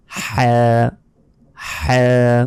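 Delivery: হ্যাঁ as Arabic "ḥaa", strong breathy friction from the throat; constricted-throat ha, vowel held; deep-throat h. Shown as a demonstration. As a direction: squeeze out a long rasped h in হ্যাঁ. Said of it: "এটার সঠিক উচ্চারণটি".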